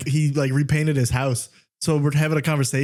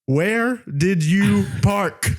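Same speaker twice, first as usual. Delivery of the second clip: "Where did you park" very slow, each word pitched high; every syllable emphasized; 6 semitones higher, much slower than usual. Retold or imitated. Dictating to Siri in frustration, every syllable of "Where did you park" emphasized. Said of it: "I'm emphasizing every".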